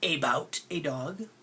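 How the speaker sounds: about a dog said as AY-bout AY dog; over-pronounced AY in about a dog; full A vowels. Shown as a demonstration. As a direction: give each A in about a dog its letter name. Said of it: "Do I say that?".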